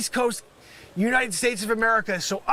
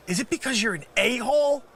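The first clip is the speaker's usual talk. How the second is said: ordinary talk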